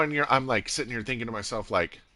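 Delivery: usual speaking voice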